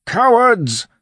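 very high, loud cry of contempt; furious yell. A narrator's reading. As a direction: yell after fleeing enemies. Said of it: "Peterus yelled".

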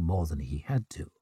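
hushed, trailing whisper. A narrator's reading. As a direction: drop to a whisper. Narration dropped to whisper.

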